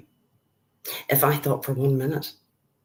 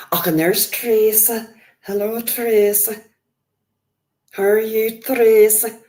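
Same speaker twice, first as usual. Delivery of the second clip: loud, raised-pitch attempt at Yorkshire; mock Yorkshire accent; 5 semitones higher, 7 dB louder than usual. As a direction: greet a viewer in badly faked Yorkshire. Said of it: "that's meant to be a Yorkshire accent. It's not".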